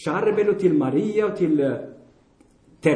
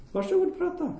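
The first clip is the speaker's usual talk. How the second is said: much higher, soft sarcastic go ahead; mocking dare; 11 semitones higher, 6 dB quieter than usual. Real, not acted. Preacher daring the congregation to talk to a plant, sarcastic. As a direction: taunt them to try it.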